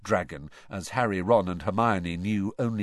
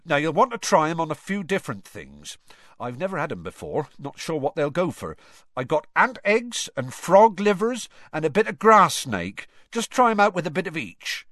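Hagrid voice; 8 semitones higher, 5 dB louder than usual. Acted.

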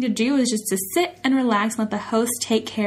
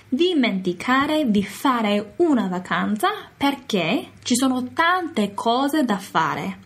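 slow model phrase; slower than usual. Shown as a demonstration.

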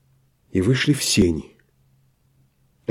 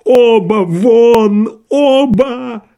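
very high, long-drawn furious shouts; frenzied cry; 11 semitones higher, 5 dB louder, much slower than usual. A narrator's reading. Doctor throwing both visitors out, frenzied cry. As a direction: scream each word out, stretched and wild. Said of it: "крикнул он не своим голосом".

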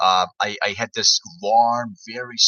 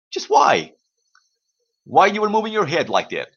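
high-pitched, baffled questioning; acted bafflement; 7 semitones higher than usual. Viewer puzzled by the head movements, baffled. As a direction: pitch jumps up in mock disbelief.

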